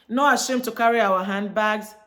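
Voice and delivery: usual speaking voice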